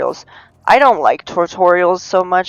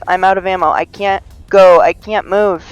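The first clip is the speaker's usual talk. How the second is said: ordinary talk, nothing marked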